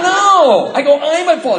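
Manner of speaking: high, loud insisting; insistent. Retold or imitated. Speaker insisting to his sponsor, high and loud.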